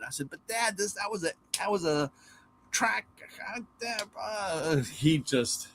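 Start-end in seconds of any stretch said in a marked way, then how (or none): 0.3-4.8 high, soft, flustered stammer; a boy caught out, fumbling for words; stammering kid. Retold or imitated. Boy stammering to dad, quoted.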